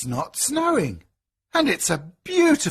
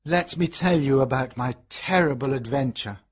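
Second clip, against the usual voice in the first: sad; 3 semitones lower than usual. A narrator's reading. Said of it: "he says sadly".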